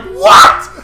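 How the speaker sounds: high, loud disbelieving what; loud mock disbelief; mock shock. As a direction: yelp it in mock outrage. Shocked progress lost.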